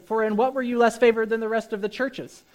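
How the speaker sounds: sarcastic. Acted. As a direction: build into a mock apology, loud and sarcastic. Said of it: "Hear the sarcasm?".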